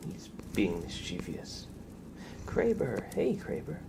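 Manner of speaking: usual speaking voice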